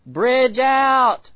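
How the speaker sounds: high, loud drawn-out shout; yelled warning. Acted. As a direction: call out an urgent warning of danger ahead. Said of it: "like the Holy Spirit yelling".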